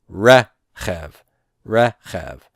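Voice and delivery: "rechev" split in two, weight on the first syllable; non-final stress. Shown as a demonstration. Teacher showing non-final stress, first syllable stressed in "rechev". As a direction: stress the start of "rechev" and let the end fall. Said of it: "this is non-final stress".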